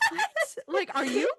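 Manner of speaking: high, loud, laughing outrage; mock shock. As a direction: overact surprise for kindergartners. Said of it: "So I'll be like".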